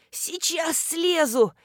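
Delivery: slow, high, strained grunt of effort; groaning with effort. A narrator's reading. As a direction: drag it out as if heaving yourself up. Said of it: "прокряхтела Нина".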